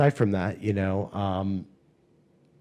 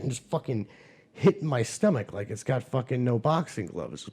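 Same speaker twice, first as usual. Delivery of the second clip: usual speaking voice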